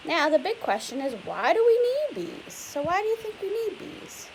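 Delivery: ordinary delivery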